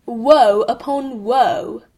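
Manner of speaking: slow, loud theatrical woe; hammed-up, high-pitched tragic declamation; Shakespearean proclamation. A narrator's reading. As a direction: draw out the lament like a tragedian. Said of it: "in quite a Shakespearean way".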